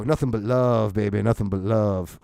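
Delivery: a hint of a laid-back musician signing off; guitar player. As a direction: croon it like a mellow bar guitarist. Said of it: "He always used to go".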